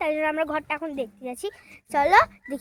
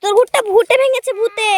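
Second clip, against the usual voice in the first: loud, higher-pitched declaration; loud announcing; 4 semitones higher, 9 dB louder than usual. Real, not acted. Child blames a ghost to crying girl, loud announcing. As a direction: call it out over the crying.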